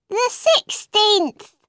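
high, slow dormouse; drowsy little dormouse voice; sleepy dormouse. A narrator's reading. Dormouse speaks.

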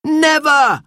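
high-pitched shouted refusal; boy's high, defiant yell. A narrator's reading. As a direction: shout back defiantly at the enemy. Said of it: "shouted Robert in reply".

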